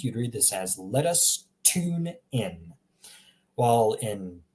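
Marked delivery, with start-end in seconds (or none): 0.8-2.7 slow American accent, "tune" as "toon". Shown as a demonstration. Teacher comparing accents for learners, American accent. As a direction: read slowly in an American accent, plain t in "tune". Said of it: "in an American accent".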